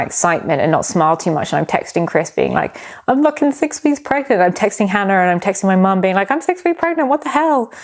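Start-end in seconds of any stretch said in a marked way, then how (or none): 3.1-4.4 high-pitched giddy text-message voice; excited disbelief. Retold or imitated. Acting out excited texts to partner. 6.3-7.7 very high, giddy squeal without extra volume; excited disbelief. Retold or imitated. Acting out excited texts to mum.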